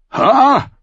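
loud, high bellowed ha; booming greeting shout; roaring laugh-shout. A narrator's reading. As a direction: bark it out like a big wildling. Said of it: "brüllte Tormund".

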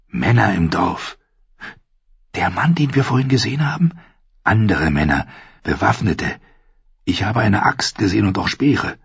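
usual speaking voice

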